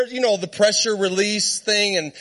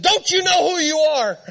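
higher, exasperated mock outburst; retold crying out; 7 semitones higher than usual. Preacher crying out to his horse, mad and exasperated. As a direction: plead indignantly, pitched up. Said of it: "I got mad at the horse".

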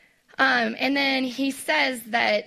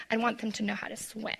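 unvoiced aside to parents; whispered aside; 6 dB quieter, faster than usual. Real, not acted. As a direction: drop to a whisper, confiding.

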